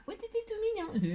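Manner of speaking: soft, very high cutesy voice; cutesy squeal. Pretend. Crafter cooing over her decorated box, cutesy high voice. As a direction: coo it in a tiny high voice.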